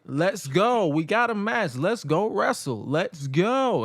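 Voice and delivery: lightly hammed-up mama rousting someone; mock yell, starting high then easing. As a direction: scold like a mama getting him moving.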